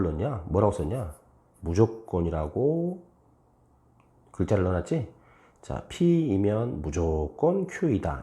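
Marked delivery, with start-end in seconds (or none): 5.9-8.2 very slow, 무조건 set apart by pauses; deliberate demonstration, 무조건 spaced out; model reading with 무조건. Shown as a demonstration. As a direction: read it slowly, drawing out 무조건 as its own word. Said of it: "이렇게 읽어봐".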